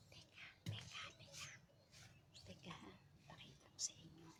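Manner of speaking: whispering. Real, not acted. Whispering near the nest.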